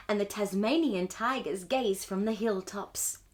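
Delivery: ordinary delivery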